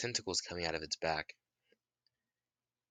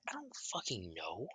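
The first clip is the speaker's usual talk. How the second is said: muttered; 9 dB quieter than usual. Retold or imitated. Kiku answering Naruto, muttered softly. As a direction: mutter it under your breath. Kiku snaps back quietly.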